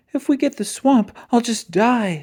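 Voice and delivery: put-on high, dread-filled kid voice; a kid half panicking at the thought; frightened kid. A narrator's reading. Scared kid dreads getting the swamp, high and fretful.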